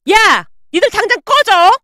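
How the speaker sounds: loud, high-pitched explosion of anger; furious shout. Acted. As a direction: yell at them to get out. Mother explodes and throws them out.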